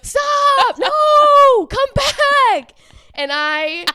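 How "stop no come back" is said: high, loud imitated yells; mock screaming crowd. The crowd calling the swimmer back, mock screaming. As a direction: yell her back like the crowd did. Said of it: "everyone screaming".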